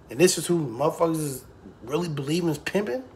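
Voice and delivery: usual speaking voice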